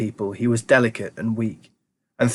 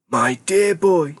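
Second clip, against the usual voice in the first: put-on high, slow old man's voice; fond old grandpa; 5 semitones higher, much slower than usual. A narrator's reading. Grandpa Joe addressing Charlie, high and slow. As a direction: savour the words like an old grandpa.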